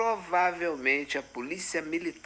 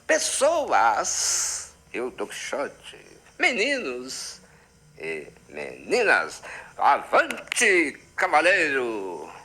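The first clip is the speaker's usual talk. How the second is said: knight's battle call; 4 dB louder, much slower than usual. Acted. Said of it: "eu tô encarnado em Dom Quixote".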